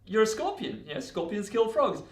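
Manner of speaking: high-pitched incredulous retort; insistent objection. Acted. Frog answering why he distrusts the scorpion, high-pitched insistent objection. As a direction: protest as if it were obvious.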